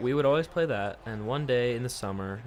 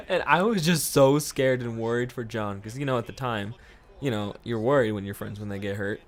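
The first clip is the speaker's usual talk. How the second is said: ordinary delivery, nothing marked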